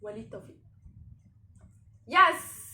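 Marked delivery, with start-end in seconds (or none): 2.1-2.7 loud, high cheer of victory; triumphant yes. Real, not acted. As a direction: shout it in triumph. Cheers at right answer.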